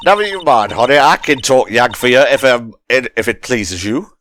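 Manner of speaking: put-on light Southern US accent, sweet-talking; a hint of a Southern belle calling someone honey; southern drawl. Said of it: "This accent is very fun".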